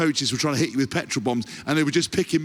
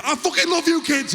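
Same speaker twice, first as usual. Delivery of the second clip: very high, hammed-up angry yell; aggressive affection. Dad telling his kids, aggressively unfriendly. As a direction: yell love like a threat, northern English. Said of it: "they can't say anything in a friendly way".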